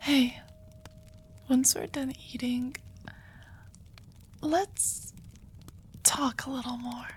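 hushed and slow, near tears; soft teary ASMR whisper; tearful intimate whisper. Acted. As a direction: whisper as if overwhelmed with relief. Tearful whisper, then pizza.

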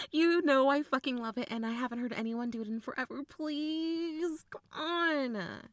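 excited, wheedling plea; playful begging. Acted. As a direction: beg eagerly for a favourite party trick. Begging for the impression.

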